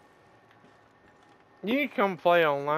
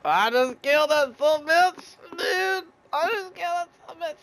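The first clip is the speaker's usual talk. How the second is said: excited; 13 semitones higher, 6 dB louder than usual. Real, not acted. Triumph after a kill.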